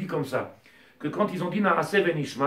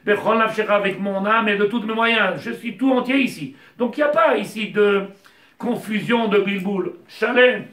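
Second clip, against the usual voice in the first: raised, louder sermon voice driving each question home; fervent preaching; 4 semitones higher, 6 dB louder than usual. Acted. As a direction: preach it to the congregation with fire. Rabbi preaching at full force.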